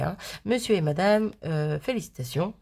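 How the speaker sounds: ordinary talk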